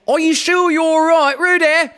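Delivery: high, loud worried mother calling; anxious Kanga. A narrator's reading. Kanga calls anxiously to Roo in the stream, high and loud. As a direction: call out anxiously for her little one.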